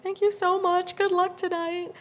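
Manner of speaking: high, gushing fan voice; reenacted starstruck gratitude; excited thanks. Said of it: "I was just so excited".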